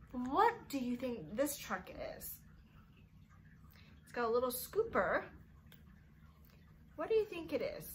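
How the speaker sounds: put-on bright, sing-song talk for little kids; preschool teacher voice. Teacher to her class, sing-song and coaxing. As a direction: coax small children along. Teacher talking to young children.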